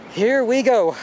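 loud, high and thrilled; excited shout. Real, not acted. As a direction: belt it out at the view. Excited cry at the view.

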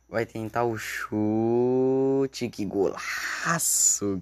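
slow drawn-out commentator build-up; explosive goal commentary. Acted. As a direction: stretch it like a TV narrator. Narrating a shot like commentator. (narração de gol arrastada)

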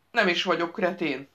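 ordinary delivery, nothing marked

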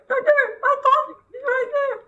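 very high, loud alarm; frightened falsetto cry; panicked squealing. Real, not acted. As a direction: squeal in rising panic that it is there. Panic as threat appears.

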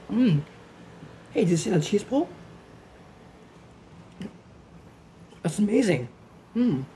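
talking between bites; muffled mm while chewing; chewing with mouth full. Real, not acted. Seen 0: Eats pizza just before; closed-mouth chewing, puffed cheeks throughout, no pizza shown.

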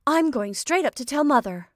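loud, high tattling threat; indignant announcement; childish threat. A narrator's reading. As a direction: threaten to tell, like squabbling sisters. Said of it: "just as they had used to say".

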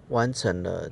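ordinary delivery

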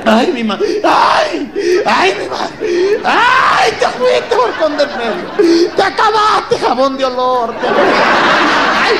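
over-the-top funeral lament, shouted; hammed-up widow's wail. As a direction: wail at the corpse like a professional mourner. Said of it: "lloran que sienten que el espíritu se les está saliendo".

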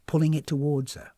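ordinary delivery

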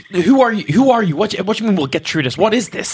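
startled captive. Acted. Player voices frightened Isabel.

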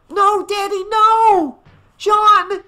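very high, loud horrified cries; crying out. A narrator's reading. Family watching father plunge into the lake, horrified cry. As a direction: scream out in alarm. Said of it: "Missus Shepherd cried".

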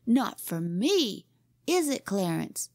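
put-on high, scornful boyish bragging; derisive. A narrator's reading. Boy belittles his rival's curbstone feat, derisive. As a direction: scoff at his feat and top it with your own. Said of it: "Jimmy's derision was a splendid sight".

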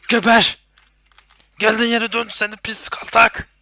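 loud, high enraged yelling; furious shout. Real, not acted. Gamer fighting a monster, furious shout. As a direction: scream it while shooting.

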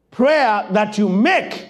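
ordinary talk, nothing marked

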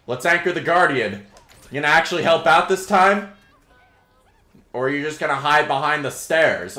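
ordinary talk

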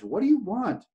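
high, exasperated yell. Acted. Man yelling over the edge at the stranger, exasperated yell. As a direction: demand an answer from below. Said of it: "he yelled over the edge".

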